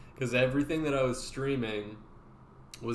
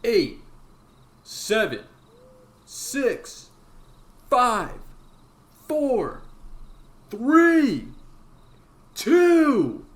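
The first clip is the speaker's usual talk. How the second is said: rising louder and higher; building countdown; 11 semitones higher, 11 dB louder, much slower than usual. Acted. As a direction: climb and build each number. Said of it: "dramatic countdown".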